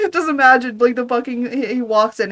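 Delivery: laughing loudly through the words; can barely talk for laughing. Real, not acted. Reader cracking up at his own monster joke, loud laughter.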